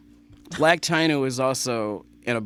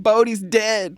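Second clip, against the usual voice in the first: upset announcement; 8 semitones higher, 6 dB louder, slower than usual. Retold or imitated. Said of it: "I'm like".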